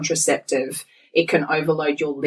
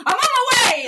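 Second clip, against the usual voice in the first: very high, gleeful mock reply; exaggerated squeal of eagerness; eager husband. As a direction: jump at the offer, voice shooting up.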